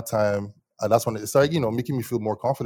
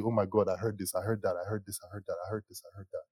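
repetitive chatter fading away; imitated chatter; 8 dB quieter than usual. Schoolmates reacting to the song at school, imitated chatter fading out. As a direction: let the repeated phrases tail off softly.

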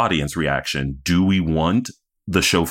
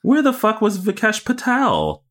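high indignant cry; mock outrage. Real, not acted. Podcaster venting about a missing character, high mock-indignant cry. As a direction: demand it in playful outrage. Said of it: "what got me mad".

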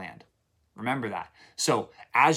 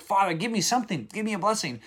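higher-pitched, mildly imitated pleading; retold tearful plea; 7 semitones higher than usual. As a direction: beg like an upset son, without real tears. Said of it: "he starts weeping".

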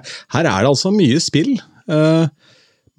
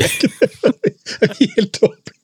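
laughing; 9 semitones higher than usual. Real, not acted. Laughs at own forgetfulness.

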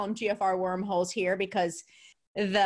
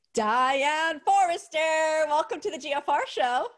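drawn-out, high, loud name announcement; big show welcome; 6 semitones higher, 4 dB louder than usual. Real, not acted. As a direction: hail the guest like a show announcer, stretching the name. Host welcomes guest onto show.

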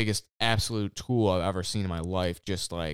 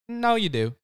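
mock correcting boss; 12 semitones higher than usual. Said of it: "she's, she's like".

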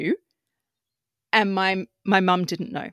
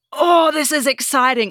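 excited thought; 6 semitones higher, 9 dB louder than usual. Retold or imitated. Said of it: "I just got really excited".

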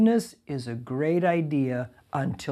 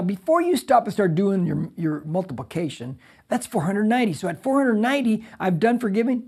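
usual speaking voice

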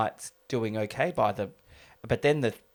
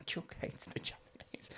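whisper; 18 dB quieter than usual. Real, not acted. Embarrassed to say racist quote.

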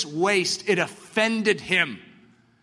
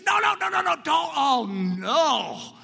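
horrified; 7 semitones higher, slower than usual. Retold or imitated. Onlookers horrified at the waste.

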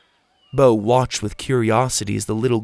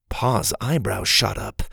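ordinary delivery, nothing marked

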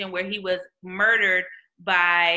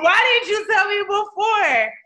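exasperated; 11 semitones higher, 6 dB louder than usual. Retold or imitated. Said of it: "I'm like".

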